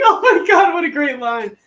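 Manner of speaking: high, loud cry of delight; delighted shout. Real, not acted. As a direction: blurt out your amazement, laughing. Mother's delighted reaction, shouted high.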